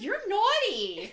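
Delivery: laughing. Retold or imitated. Quoting fan, laughing.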